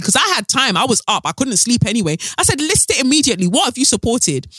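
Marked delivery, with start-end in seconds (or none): 2.6-4.5 high, demanding retort, mock-furious; heated demand. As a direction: snap back a demand at the commenter. Retold heated reply to critic.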